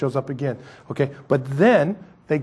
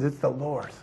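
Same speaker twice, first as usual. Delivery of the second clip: awed hush; 8 dB quieter than usual. Retold or imitated. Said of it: "he says".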